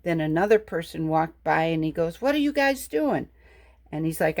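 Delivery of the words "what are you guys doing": lightly voiced curious passerby, higher pitch; a hint of a puzzled passerby, pitched up; inquisitive passerby. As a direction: imitate a nosy onlooker with lifted pitch. Acted passerby questioning the stone-soup maker.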